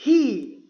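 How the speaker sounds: "He" said alone and emphatically; model pronunciation. Shown as a demonstration. Teacher asks learners to repeat, modelling "He" clearly. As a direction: sound out "He" clearly for repetition. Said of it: "Por favor, repetir".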